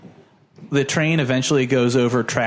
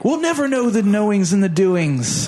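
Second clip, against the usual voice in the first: put-on kid voice; 9 semitones higher than usual. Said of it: "He's like, he's like".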